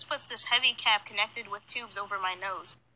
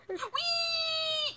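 excited. Retold or imitated. Chair elevated at dentist.

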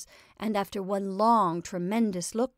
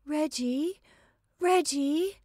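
calling out; 8 semitones higher, slower than usual. A narrator's reading. Anne calling from the garden.

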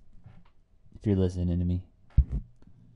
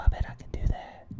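whisper; faster than usual. Real, not acted. Testing new mic settings.

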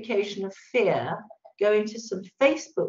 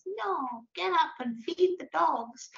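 small high-pitched nagging voice, softly put on; high, quiet mock telling-off; little inner voice; 8 semitones higher, 4 dB quieter, slower than usual. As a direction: play the little voice in your head, high and slow. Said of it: "this other little voice says".

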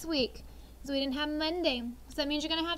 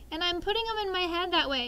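ordinary talk

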